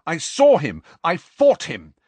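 loud, high, emphatic; heated insistence. A narrator's reading. Student Harry protesting to disbelieving professor, heated.

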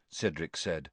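usual speaking voice

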